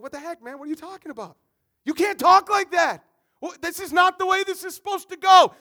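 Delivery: loud, high, protesting teammate; rising from mild disbelief to a loud protest; indignant outburst. Retold or imitated. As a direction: let the outrage build to a shout. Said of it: "you'd be like".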